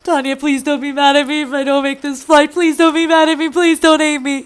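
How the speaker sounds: high, whiny imitation of begging; mock bawling. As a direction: plead in a put-on, upset boyfriend voice. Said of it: "He's bawling his eyes out".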